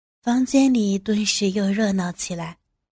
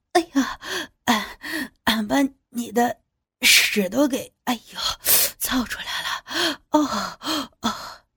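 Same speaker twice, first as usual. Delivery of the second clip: trembling, breathless; 3 semitones higher than usual. A narrator's reading. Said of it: "哆哆嗦嗦地说".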